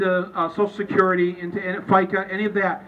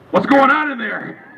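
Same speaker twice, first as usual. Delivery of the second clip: higher, louder mock wife calling in; exaggerated wife voice; 7 semitones higher, 5 dB louder than usual. Wife outside the curtain calls to her husband, exaggerated raised voice. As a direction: put on an unflattering, raised wife's voice. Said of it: "she doesn't sound like that".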